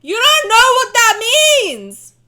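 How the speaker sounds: high loud mocking outburst; exasperated shout. Real, not acted. Streamer yelling at wine snobs, exasperated shout. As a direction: yell it at the pretend snobs.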